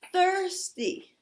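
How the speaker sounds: mother tells the little clock to yell "thirsty"; mom's yell of thirsty. Retold or imitated. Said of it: "I want you to yell".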